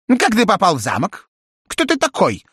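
high squawky bird voice; talking parrot. A narrator's reading. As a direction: chirp it like a curious parrot. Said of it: "спросил попугай человеческим голосом". (высокий голос попугая)

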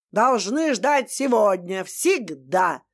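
usual speaking voice

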